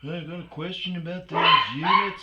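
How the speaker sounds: calling out. Retold or imitated. Man outside calling at house.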